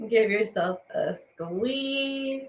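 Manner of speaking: normal talking voice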